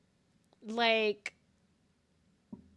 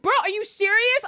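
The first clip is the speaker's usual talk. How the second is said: very high, loud protest; hammed-up incredulous yell; exasperated disbelief; 11 dB louder than usual. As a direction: shout it in incredulous mock outrage. Retold disbelief at friend backtracking.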